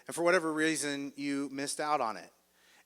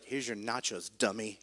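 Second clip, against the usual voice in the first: lower, softer, sulky grumble; upset grumble; 7 semitones lower, 6 dB quieter than usual. Retold or imitated. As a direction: mutter it, fed up with the errand. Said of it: "the most upset I've ever seen him".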